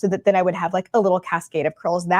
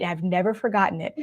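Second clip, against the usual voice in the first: ordinary talk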